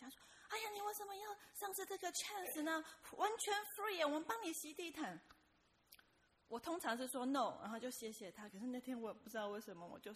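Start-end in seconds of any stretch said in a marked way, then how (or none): 0.5-5.2 higher, coaxing sales pitch; pushy saleswoman. Retold or imitated. Saleswoman urging the narrator not to miss the chance, higher wheedling pitch. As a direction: wheedle her into saying yes. Saleswoman pushing a free offer.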